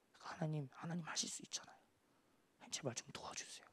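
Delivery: hushed, broken whisper of a repentant prayer; whispered tearful prayer. Retold or imitated. Preacher reliving his boyhood prayer, in a trembling whisper. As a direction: barely breathe the words, pleading. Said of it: "제 입술에서 뭐라고 고백했냐".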